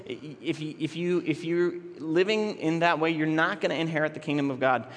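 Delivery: normal talking voice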